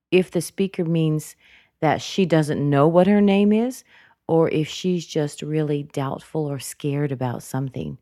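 usual speaking voice

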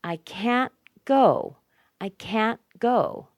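slow, high, loud "can't", then equally strong "go"; two strong beats on "can't" and "go"; unreduced CAN'T. Shown as a demonstration. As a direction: hit "can't" full, pause, then "go". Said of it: "is never reduced".

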